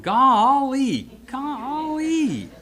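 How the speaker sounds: put-on aw-shucks Southern drawl of golly, a Gomer Pyle accent. Old friend's surprise at meeting again, in a Gomer Pyle accent. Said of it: "in his Gomer Pyle-ish accent".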